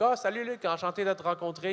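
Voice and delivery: lightly voiced eager French; chirpy French greeting. Comedian greets his new boss in French, mildly chirpy. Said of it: "I'm like".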